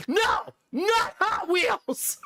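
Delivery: put-on high, slow whiny child; whiny kid voice. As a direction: play the whining kid. Child's voice arguing about toys.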